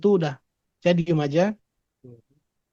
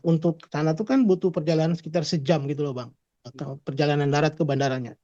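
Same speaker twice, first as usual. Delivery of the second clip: usual speaking voice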